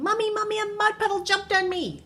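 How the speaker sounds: put-on high-pitched child's wail for Mommy; high, urgent tattling yell. A narrator's reading.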